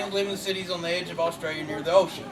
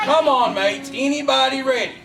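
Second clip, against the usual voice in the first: louder, higher pep-rally shout; rallying call; 4 semitones higher, 5 dB louder than usual. Acted. Host coaxing a shy audience to get ready, rallying loudly. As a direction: get the crowd fired up.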